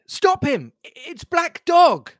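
very high, urgent cry; crying out in alarm. A narrator's reading. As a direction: call out high, stumbling in alarm. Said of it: "I cried".

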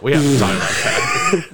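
loud teasing shout through laughter; laughing shout. Real, not acted. Laughing call-out at friend.

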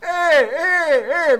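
high, loud calls of Eh from far off; shouting Eh from a distance; retold yelling. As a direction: call out across the beach. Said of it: "Me grita el Diego así".

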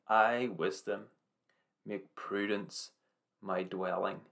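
slow, each phrase weighed; slow deliberate verse. Acted. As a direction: lay the key verse down word by word. Key verse repeated.

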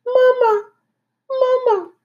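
very high, tiny peeping voice; tiny muffled peep. Acted. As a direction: peep it like a gosling inside an egg. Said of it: "peeped a tiny muffled voice".

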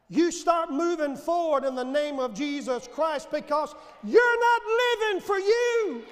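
builds from firm to a high, loud shout; rising preacher shout. Retold or imitated. Preacher voicing the Holy Spirit to a weary believer, building shout. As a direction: rouse the tired believer to his feet. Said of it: "the Holy Spirit rises up inside of me and says".

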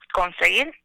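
ordinary talk, nothing marked

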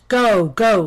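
loud, commanding lion; forceful, angry order; lion ordering away. A narrator's reading. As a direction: bellow the dismissal. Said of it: "lifted his huge paw and pointed".

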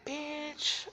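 high squealed exclamation; high squeal of disbelief; squealed bitch. Real, not acted. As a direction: shriek it in disbelief. Exasperated at himself.

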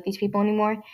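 usual speaking voice

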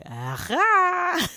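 loud, high eureka; triumphant aha. Retold or imitated. Husband showing he made his point, loud triumphant aha. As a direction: crow it in triumph. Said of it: "he's looking at me like".

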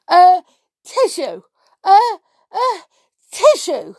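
big, high, loud storybook sneeze; acted sneezes. A narrator's reading. Pixie with a dreadful cold, hammed-up sneezing. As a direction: build up and burst into a mock "atishoo". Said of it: "I've got a dreadful cold".

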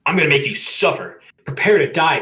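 high, loud villain threat; bellowed. A narrator's reading. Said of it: "Waluigi bellowed".

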